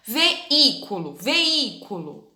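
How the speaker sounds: veículo slowly, stressed í open and long. Shown as a demonstration. Teacher showing pupils the open í in veículo. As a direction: draw out the í in veículo. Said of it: "esse i é o som bem aberto".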